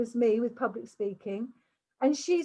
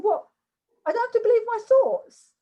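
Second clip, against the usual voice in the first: high, loud, quick incredulous question; astonished realization; 9 semitones higher, 6 dB louder, faster than usual. Retold or imitated. Coach acts out her young client's revelation, astonished disbelief. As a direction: imitate a teenager stunned by good news.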